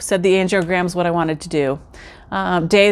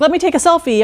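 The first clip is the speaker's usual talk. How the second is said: upbeat; 8 semitones higher, faster than usual. Retold or imitated. Cheerful self before treadmill.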